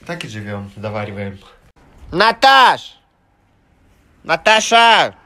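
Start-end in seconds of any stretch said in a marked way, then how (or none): 2.1-5.1 calling out. Real, not acted. Calling out to someone.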